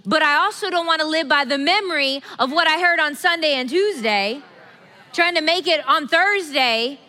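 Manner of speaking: loud and high, building; emphatic preaching. Real, not acted. Pastor building a rousing point to the congregation, loud. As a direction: build each line, preaching hard.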